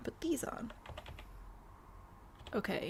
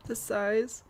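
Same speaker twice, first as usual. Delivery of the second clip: hiccups; 2 semitones higher, 4 dB louder than usual. Real, not acted. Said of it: "I've got the hiccups again".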